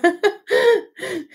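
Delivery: high, bashful laugh; self-conscious laugh. Real, not acted. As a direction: laugh at yourself, a bit embarrassed. Laughs at own old video.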